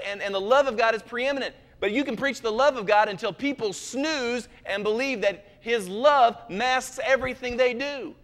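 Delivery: loud, fervent preaching, building; full-force preaching. Acted. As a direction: preach it hard to the congregation.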